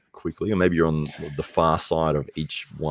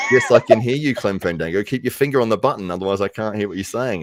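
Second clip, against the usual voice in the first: irritated voice actor; 5 semitones higher, 5 dB louder than usual. Retold or imitated. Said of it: "He's like".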